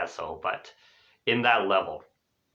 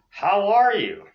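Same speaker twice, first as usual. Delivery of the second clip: high, louder lilting question; cheery greeting; 9 semitones higher, 5 dB louder than usual. Shown as a demonstration. Teacher showing the first thing to say, cheery greeting. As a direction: sing it out as a welcome.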